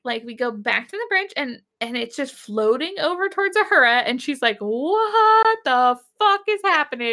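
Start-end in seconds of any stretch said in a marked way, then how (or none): 4.6-7.1 high, drawn-out incredulous cry; comically stunned, pitched up; mock bewilderment. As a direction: stretch it out in exaggerated disbelief. Acting out Uhura's alarm.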